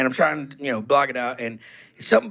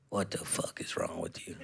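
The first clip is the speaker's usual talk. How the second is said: imitating mom's low, soft, unvoiced fury; whispered fury; 14 dB quieter than usual. Mom whispering her fury at her son over the phone. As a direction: hiss it out like a stunned furious mother. Mom retells, long pause, angry.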